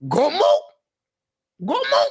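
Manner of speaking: high, rising call of a name; calling grandma. Acted. Grandson calling out to his grandma, high rising call. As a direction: call out to her in another room.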